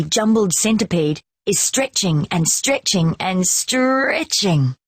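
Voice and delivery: ordinary delivery, nothing marked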